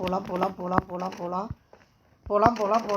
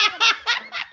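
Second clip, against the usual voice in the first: high-pitched burst of giggles; laughing; 12 semitones higher than usual. Real, not acted. Player cracking up during hide and seek, laughing.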